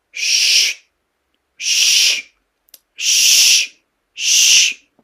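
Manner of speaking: forceful hissed ch, ch; loud shushing ch. Shown as a demonstration. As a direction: blow out a strong ch sound. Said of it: "Et si je fais".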